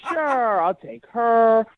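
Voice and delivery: exaggerated, exasperated fake yes; sarcastic. Real, not acted. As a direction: list each guest with mounting sarcasm. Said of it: "I'm being sarcastic".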